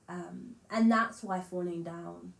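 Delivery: usual speaking voice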